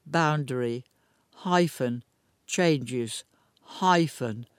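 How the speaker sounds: slow, word-by-word web address dictation. Real, not acted. Newsreader dictating a complicated petition address to listeners, slowly. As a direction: spell it out slowly, pausing after each piece. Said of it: "I will say this slowly".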